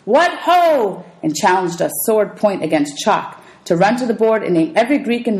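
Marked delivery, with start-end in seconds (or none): 0.0-1.0 playful armored cheer; shouted. Acted. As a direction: call out like a knight rallying troops.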